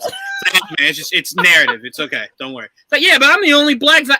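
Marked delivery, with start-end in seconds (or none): 0.6-2.7 placating. Acted. Director calming an actor about his zombie's death, mock soothing. 3.0-4.1 high mock protest. Zombie actor objecting to the script, mock indignant.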